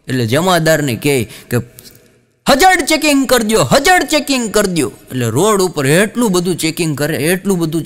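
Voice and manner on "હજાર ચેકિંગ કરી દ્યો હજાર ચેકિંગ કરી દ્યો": bossy order. Retold or imitated. Inspector orders constable.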